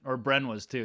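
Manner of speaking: normal talking voice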